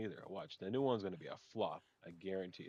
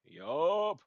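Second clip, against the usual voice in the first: excited; 8 semitones higher, 11 dB louder than usual. Real, not acted. Excited about a legendary animal.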